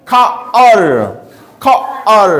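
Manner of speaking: খা, then আর, split by pauses; sounded out slowly. Shown as a demonstration. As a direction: break the word into খা and আর for learners. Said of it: "এটা হবে কি?".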